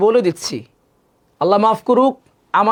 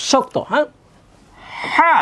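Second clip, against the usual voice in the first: ordinary talk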